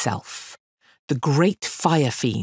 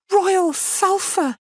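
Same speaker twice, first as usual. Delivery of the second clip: much higher, slow gasping plea; gasped girl; 4 dB louder, slower than usual. Acted. Girl gasping her request to the fiend, high and slow. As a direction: gasp it out breathless. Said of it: "she gasped".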